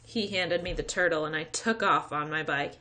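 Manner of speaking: ordinary delivery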